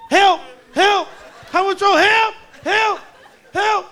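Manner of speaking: high, loud desperate yelps; calling for help. Acted. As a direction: cry out to the patrol car in hammed-up panic. Calling patrolman from car window.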